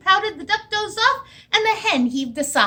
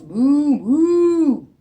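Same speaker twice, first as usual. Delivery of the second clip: mooing cow; 7 dB louder than usual. Acted. Librarian hears only cow sounds.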